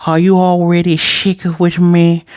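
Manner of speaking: put-on slow, high, slurry drunk voice. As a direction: play it tipsy, like the Grinch. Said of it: "how do I do a drunk voice?".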